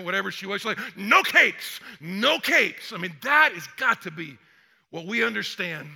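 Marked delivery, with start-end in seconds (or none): 1.0-2.9 loud, emphatic mock Germanic accent; Swedish-Germanic accent. Costume designer banning capes to a superhero, mock Swedish-Germanic accent. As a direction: lightly put on a Swedish-German accent, punching it out. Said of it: "in this kind of Swedish, Germanic accent".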